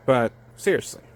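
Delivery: normal talking voice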